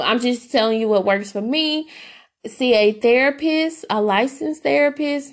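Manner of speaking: usual speaking voice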